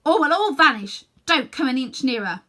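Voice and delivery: loud warning call; crying out. Acted. Boy warning the witch not to come nearer, loud. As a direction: warn her off, loudly. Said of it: "cried Diggory".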